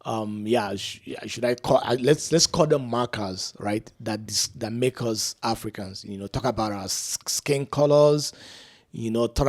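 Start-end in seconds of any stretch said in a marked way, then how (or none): none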